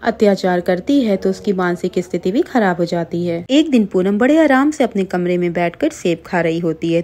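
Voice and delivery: plain reading voice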